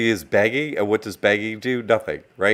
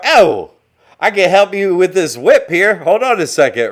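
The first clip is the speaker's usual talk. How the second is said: high, loud, gloating master's reply; the master answering the slave, mocked; mock slave owner; 8 semitones higher, 9 dB louder than usual. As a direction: answer the beggar with cheerful cruelty, higher and louder. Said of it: "How did that go out of the plantation?".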